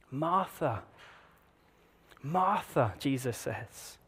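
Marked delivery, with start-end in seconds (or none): 0.1-2.9 soft, quiet tender call; a soft, loving rebuke; gentle rebuke. Retold or imitated. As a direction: call her name gently, like a friend. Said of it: "I don't think Jesus would have said these words harshly".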